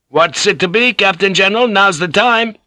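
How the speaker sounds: high, pressing cry; gunner's high-pitched urgent call. Acted. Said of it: "the master gunner cried".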